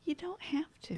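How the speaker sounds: very high and soft; a tiny, high-pitched coaxing voice. Real, not acted. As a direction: say it small and high. Coaxing echo of her own line.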